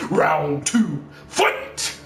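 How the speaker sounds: booming fighting game announcer; Shao Kahn. Retold or imitated. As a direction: call the round like an arcade announcer. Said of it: "feel like Shao Kahn from Mortal Kombat".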